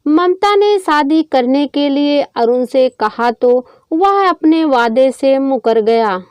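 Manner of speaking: usual speaking voice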